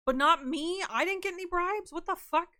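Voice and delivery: high, loud fake indignation; mock outrage. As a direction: play hurt at being left out.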